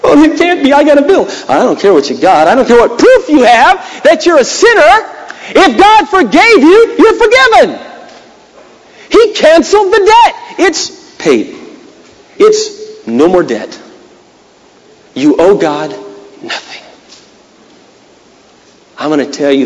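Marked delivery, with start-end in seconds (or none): 0.0-1.2 disbelief. Retold or imitated. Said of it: "she goes". 1.5-16.8 emphatic declaration. Retold or imitated. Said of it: "she goes".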